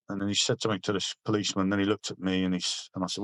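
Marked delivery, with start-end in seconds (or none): none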